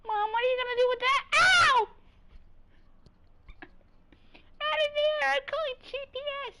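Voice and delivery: very high, fast squeaky voice. Pretend.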